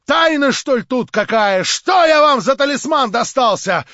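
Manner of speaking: high, loud angry questioning; angry exclamation. A narrator's reading. Stavrogin angrily demanding an answer from Verkhovensky. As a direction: fling the questions out in fury. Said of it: "в решительном гневе и изумлении".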